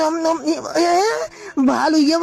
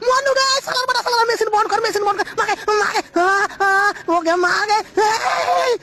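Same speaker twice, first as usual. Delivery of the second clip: high, frantic cries for mother; panicked yelling; 7 semitones higher than usual. Acted. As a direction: scream for help as it overwhelms you. Begging someone to stop machine.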